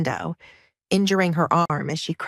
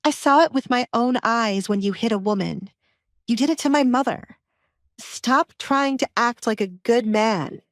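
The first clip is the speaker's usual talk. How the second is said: higher-pitched accusing voice; defiant daughter, accusing; 5 semitones higher than usual. Acted. Alessandra confronts father about hitting mother, defiant accusation. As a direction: throw the accusation back at him.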